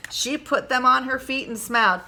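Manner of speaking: usual speaking voice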